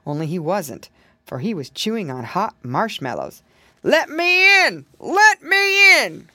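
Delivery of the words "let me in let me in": loud, high, drawn-out demand; crying out. A narrator's reading. Bear demanding to be let into the house, crying out. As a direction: call it slowly and loudly, insisting. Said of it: "cried the voice again".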